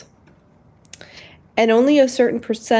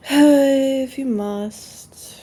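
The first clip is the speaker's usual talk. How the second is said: reluctant sigh; 4 semitones higher, much slower than usual. Real, not acted. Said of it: "sighs, lying down".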